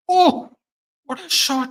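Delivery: sudden high delighted gasp of a cry; amazed. Real, not acted. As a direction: jump up at the great shot. Reacting to a great shot.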